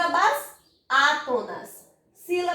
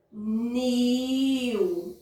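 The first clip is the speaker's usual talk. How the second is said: "nil" held long and lower; stretched syllable; 4 semitones lower than usual. Shown as a demonstration. Teacher naming the syllable she extends, long drawn "nil". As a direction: draw out "nil" on a steady note. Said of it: "a sílaba que eu estendo mais o som".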